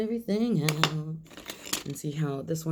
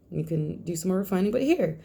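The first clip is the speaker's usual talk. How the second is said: ordinary delivery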